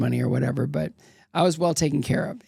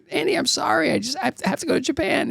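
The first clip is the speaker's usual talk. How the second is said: high pleading apology; mock pleading young skater; pleading himself; 11 semitones higher, faster than usual. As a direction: beg the boss, voice pitched up. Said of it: "It's like".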